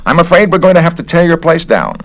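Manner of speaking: the busybody official sizing him up; officious investigator. Acted. Garrett again.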